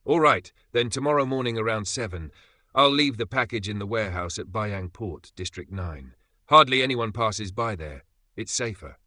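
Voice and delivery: ordinary delivery, nothing marked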